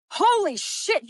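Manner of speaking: high, loud retold gasp of surprise; imitating the coach's shocked outburst; surprised exclamation. Coach Jo reacting to her hamstrings, mock-shocked outburst.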